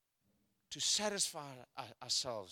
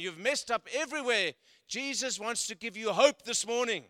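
rising, louder sermon build to a peak; preaching at full force; 3 semitones higher, 8 dB louder than usual. Acted. Pastor preaching hope to the church, building loud and fervent. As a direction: preach it hard, climbing with each line.

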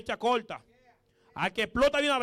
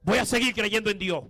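higher, louder preaching that presses hard on each line; full-force preaching; 5 semitones higher, 7 dB louder than usual. Acted. Preacher to the congregation about keeping faith, in full-force preaching. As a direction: declare it from the pulpit so the whole church hears.

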